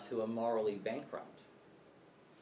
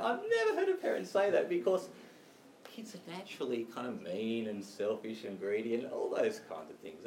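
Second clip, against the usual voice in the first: usual speaking voice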